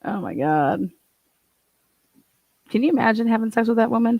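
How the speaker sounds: normal talking voice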